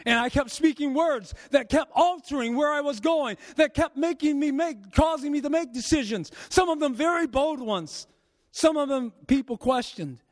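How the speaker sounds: preaching. Acted.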